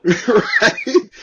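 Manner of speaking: high, loud "right?" through real laughter; laughing. Real, not acted. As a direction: laugh it out as you ask. Laughing at the sergeant story.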